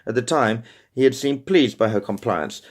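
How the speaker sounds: plain reading voice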